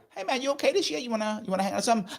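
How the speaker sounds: casual friendly ask. Retold or imitated. Checking on a friend this year, breezy. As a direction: toss off a light, easy invitation. Asking lonely friend to hang.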